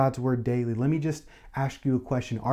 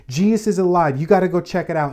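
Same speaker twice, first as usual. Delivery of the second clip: excited news; 4 semitones higher, 6 dB louder, faster than usual. Retold or imitated. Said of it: "they say".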